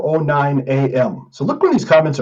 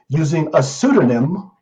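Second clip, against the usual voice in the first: ordinary delivery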